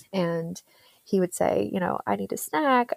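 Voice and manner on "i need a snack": a little higher, a hint of a pleading child; lightly voiced whiny kid. Son asking mom mid-session, mild childish whine. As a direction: whine it like a six-year-old.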